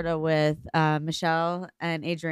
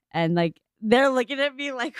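ordinary talk, nothing marked

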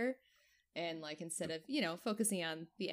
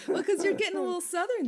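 laughing loudly while teasing; 13 semitones higher, 10 dB louder than usual. Real, not acted. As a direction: get it out through laughter.

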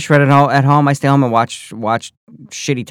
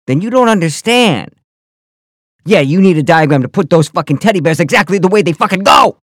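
raised, building veteran-style tirade, ending in a shout; worked-up mock outrage, getting higher and louder; indignant rant; 5 semitones higher, 5 dB louder than usual. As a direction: rant with mounting outrage to a yelled finish.

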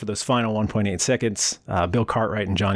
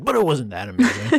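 mock dismissive; 4 semitones higher than usual. Mocking Pippen's dismissive tone.

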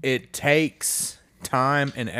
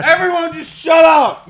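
sudden high, loud yell; shouting; 10 dB louder than usual. Real, not acted. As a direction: yell at him to shut up. Yelling at co-host to stop.

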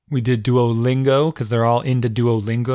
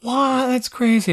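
high squeaky disbelief, both words pitched way up; shocked kids; 14 semitones higher, 4 dB louder, slower than usual. Retold or imitated. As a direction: mimic kids outraged at the new rule. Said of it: "They're like".

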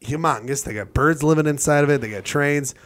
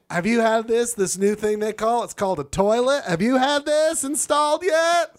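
mock salesman pitch; 8 semitones higher than usual. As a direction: sell the toilet like a carnival barker. Mocking Southern toilet sales pitch.

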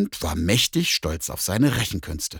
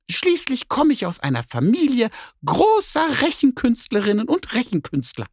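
much higher, bold cat lecture; boastful cat; 4 dB louder than usual. Acted. Cat lectures the bear that everyone can do maths, high and proud. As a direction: puff yourself up and brag.

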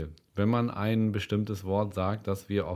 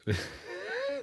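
hohes Auflachen; 7 dB quieter than usual. Real, not acted. Lacht über Witz.

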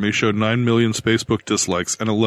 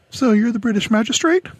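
higher, businesslike clerk voice; brisk woman clerk; 13 semitones higher than usual. A narrator's reading.

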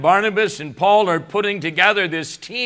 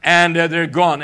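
preaching; 4 semitones higher, 4 dB louder than usual. Acted. Preaching builds in intensity.

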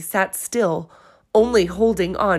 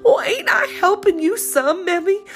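tearful plea; 11 semitones higher, 4 dB louder than usual. A narrator's reading. Polly pleading with mother.

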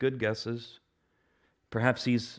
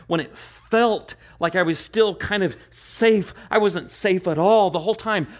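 higher, louder fervent cry; fiery sermon climax; preaching at full force; 8 semitones higher, 5 dB louder than usual. Real, not acted. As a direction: pour it out with passion. Preacher erupting in fervor.

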